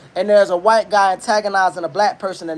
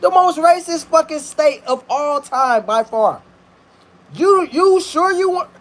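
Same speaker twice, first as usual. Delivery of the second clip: urgent warning, rising higher; Javon's warning; 7 semitones higher than usual. Retold or imitated. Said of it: "Javon done gave him a heads up".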